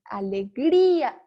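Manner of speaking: alegría drawn out slowly, GRÍ swelling loud; exaggerated stress on GRÍ. Shown as a demonstration. Teacher demonstrating emphasis for the accent, long stressed GRÍ in alegría. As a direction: stretch alegría and hit the í hard. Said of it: "hago más énfasis".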